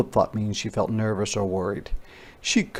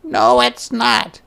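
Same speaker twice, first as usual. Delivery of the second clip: high, louder, slow shouted no; a girl yelling back; high shout of denial; shouting Ruthie; 13 semitones higher, 5 dB louder, slower than usual. Acted. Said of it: "shouted Ruthie".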